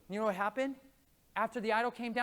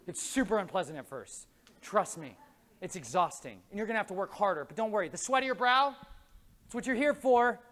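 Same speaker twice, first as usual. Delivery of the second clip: ordinary talk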